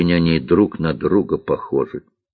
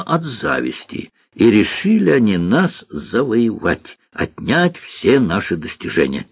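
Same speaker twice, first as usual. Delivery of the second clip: higher, emphatic recounting in character; storytelling cook; 8 semitones higher than usual. Acted. Cook explains the war to girl, emphatic. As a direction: pitch it up, proud of the old days.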